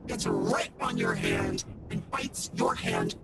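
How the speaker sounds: very low, slow monster voice; creepy deep pumpkin growl; pumpkin head. Acted. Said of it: "I've been this pumpkin voice the whole time".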